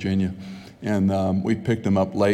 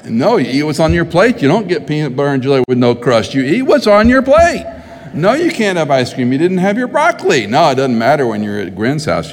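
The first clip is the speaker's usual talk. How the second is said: higher, louder hammed-up scolding; mock strict parent; 6 semitones higher, 6 dB louder than usual. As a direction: play the nagging parent at the dinner table.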